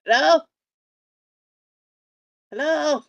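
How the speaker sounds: loud call into the dark. Acted. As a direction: shout it down the hall. Character calling out to voice.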